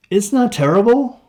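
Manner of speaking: "It's not terrible?" even and insistent rise, very high; indignant upward inflection. A narrator's reading. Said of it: "I repeated with an upward inflection".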